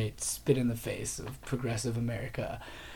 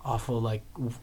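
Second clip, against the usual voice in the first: ordinary delivery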